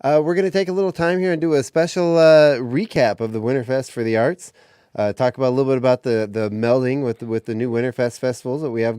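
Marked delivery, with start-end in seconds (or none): 0.0-4.5 radio announcer. Acted. Radio host opens the show.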